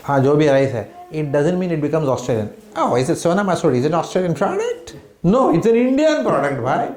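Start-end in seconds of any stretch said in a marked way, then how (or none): 2.8-4.9 higher-pitched, naive questioning; mock surprise. Acts out a mistaken buyer's question. 5.2-6.9 high-pitched, exclaimed objection; emphatic mock correction. Acts out correcting that mistaken belief.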